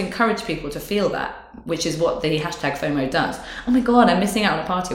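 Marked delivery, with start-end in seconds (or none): 3.7-4.9 mock panic. Mocking fomo mindset quote.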